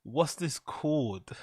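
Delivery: slow, drawn-out mock question; slow mock repeat. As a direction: drag the question out slowly. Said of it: "repeating Chris's question".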